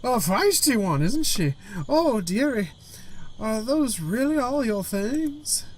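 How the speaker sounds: high, slow grandmother character voice; female Gran voice. Acted. Gran looks over horse and luggage, high slow grandmother voice. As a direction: draw it out as a fussing, high-voiced granny. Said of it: "apologize for any female voices".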